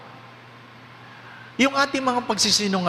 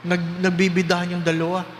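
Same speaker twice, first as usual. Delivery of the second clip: ordinary talk, nothing marked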